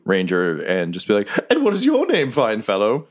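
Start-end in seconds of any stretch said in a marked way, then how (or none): 1.5-3.1 a courteous creature introducing itself; polite badger. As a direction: badger introducing himself to Quinny, high and proper.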